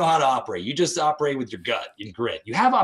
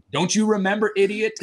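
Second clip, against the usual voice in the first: higher, louder mocking jab at a forgetful friend; mock scolding; 9 semitones higher, 5 dB louder than usual. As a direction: toss out the insult in a teasing voice. Teammates scolding him, retold.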